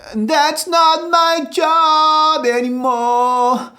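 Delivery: high, slow, held-back airy sob; strangled crying tone. Shown as a demonstration. Coach demonstrating the constipated crying sound. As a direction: sing it without full air, half strangled. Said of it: "we're not using full air".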